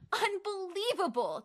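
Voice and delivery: high-pitched outraged exclamation; theatrical, incredulous outburst; indignant cry. Acted. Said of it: "Teddy cried".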